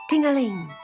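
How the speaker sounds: plain reading voice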